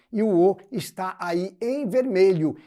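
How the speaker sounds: ordinary talk, nothing marked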